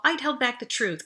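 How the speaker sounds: plain reading voice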